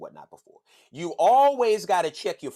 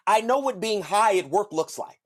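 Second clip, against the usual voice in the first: ordinary talk, nothing marked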